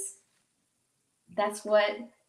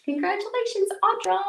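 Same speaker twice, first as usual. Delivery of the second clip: excited congratulations; 11 semitones higher, 4 dB louder than usual. Real, not acted. Cheering for her friend.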